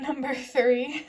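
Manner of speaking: normal talking voice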